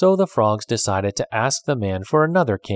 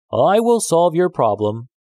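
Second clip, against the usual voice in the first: calling out; 7 semitones higher, 4 dB louder than usual. A narrator's reading. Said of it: "he called".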